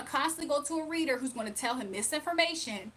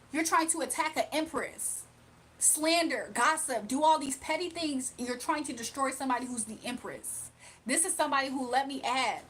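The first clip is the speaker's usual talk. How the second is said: usual speaking voice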